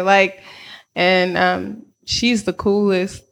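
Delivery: ordinary delivery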